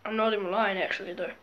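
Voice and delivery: ordinary talk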